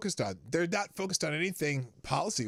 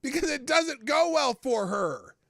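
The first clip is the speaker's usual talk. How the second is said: much higher and louder, mocking indignation; loud incredulous scoff; scoffing rant; 11 semitones higher, 7 dB louder than usual. Real, not acted. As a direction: throw the question out in disbelief. Host scoffing rhetorical rant.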